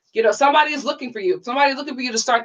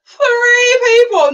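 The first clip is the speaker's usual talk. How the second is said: slow, higher, choked-up repeat; tearful; 11 semitones higher, 6 dB louder, much slower than usual. Real, not acted. Grateful streamer repeating the count to her viewers, choked up. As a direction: let the tears catch on it, slowly.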